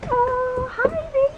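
high cooing pet voice. Real, not acted. Greeting the dog again.

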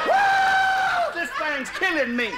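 high shrieked woo, then a desperate wail; squalling in pain. Retold or imitated. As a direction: scream like the lynx has you. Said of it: "he kept hollering".

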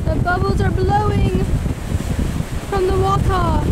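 high, loud shout; shouting over wind. Real, not acted. Shouting at the sea.

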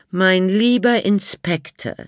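high, put-on elderly lady, dry; old lady Miss Sophie. A narrator's reading. Old Miss Sophie answers the inspector, high and dry. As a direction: play the haughty old lady.

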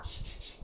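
repeated sharp shushes; unvoiced "shh, shh"; shushing. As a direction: hush a chattering crowd. Hushes the gathered women.

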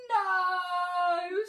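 high, loud drawn-out cry; screamed. A narrator's reading. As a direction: wail it out in horror. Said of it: "screamed Peaches and Dad".

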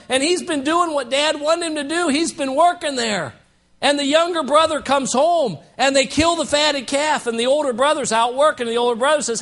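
ordinary talk

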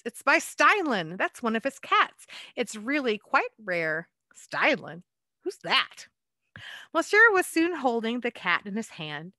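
lively picture-book read-aloud; story narration. A narrator's reading. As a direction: read it like a bedtime rhyme to children.

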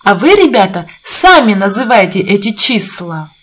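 ordinary talk

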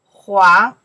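黄 very loud, rising from a dip; teacher's isolated, forceful rising 黄; second tone. Shown as a demonstration. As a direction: model 黄 with an emphatic upward glide. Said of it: "second tone here".

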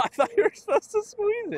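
laughing while protesting. Real, not acted. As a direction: say it through a laugh. Laughing after spraying ammonia.

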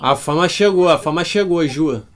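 loud gleeful chant; excited shout. Real, not acted. Teasing friend about fame.

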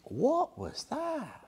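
high, slow, soft startled question; puzzled surprise. Acted. Listener startled by a caw in silence, puzzled. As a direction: wonder aloud in a high hushed voice. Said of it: "You think".

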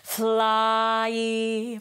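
"Fly" with its diphthong drawn out; "Fly" with a long diphthong. Shown as a demonstration. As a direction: let the vowel of "Fly" travel. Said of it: "It's a diphthong".

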